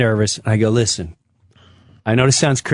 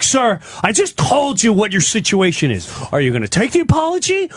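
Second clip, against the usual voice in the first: raised, pressing cop voice; exasperated cop; 8 semitones higher than usual. Acted. Cop losing patience over the apology, exasperated. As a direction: lay down the options, pitch climbing fast.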